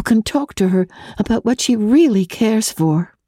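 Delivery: a hint of a sincere young fiancée; May's voice. A narrator's reading. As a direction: read the letter as gentle, earnest May. May's letter read in her voice.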